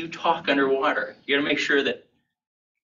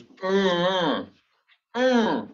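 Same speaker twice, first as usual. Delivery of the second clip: high hum with lips shut, no words; closed-mouth humming; 6 semitones higher, much slower than usual. Shown as a demonstration. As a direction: hum it as if trying to talk. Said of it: "all that was coming out was".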